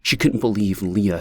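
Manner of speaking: ordinary delivery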